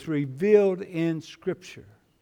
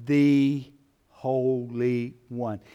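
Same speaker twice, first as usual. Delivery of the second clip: "the" drawn out and weighted, very slow; stress on THE; 3 semitones lower, much slower than usual. Shown as a demonstration. Preacher showing the Greek emphasis, stress on "the". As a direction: give "the" the full emphasis, slowly. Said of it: "the emphasis would be".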